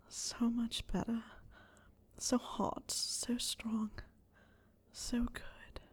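fading, unvoiced breathy whisper; breathless whisper. A narrator's reading. Harry murmuring praise to Snape, fading whisper.

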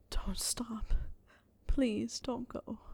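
slow, hushed pleading whisper; whispered plea. A narrator's reading. Harry begging Snape to stay, slow whisper. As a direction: beg him softly not to stop.

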